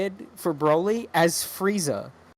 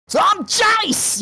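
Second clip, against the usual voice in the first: high, slow hammed-up rocker yell; mock hair metal frontman, much higher; 13 semitones higher, 4 dB louder, much slower than usual. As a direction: belt it like an eighties glam rocker. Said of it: "old hair metal guy".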